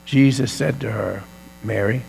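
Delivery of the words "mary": tender calling. Acted. Jesus calling Mary by name.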